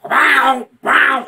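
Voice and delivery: loud, high lightsaber swoosh; imitated lightsaber. Showing a friend Vader attacking Obi-Wan, imitated lightsaber noise. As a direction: mouth two sharp saber swings.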